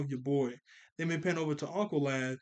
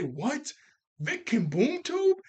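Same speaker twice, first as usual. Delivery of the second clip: shocked disbelief; 10 semitones higher, 4 dB louder, slower than usual. Retold or imitated. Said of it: "Brion and Beast Boy both say".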